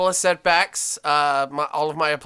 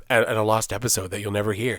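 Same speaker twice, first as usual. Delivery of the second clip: ordinary talk, nothing marked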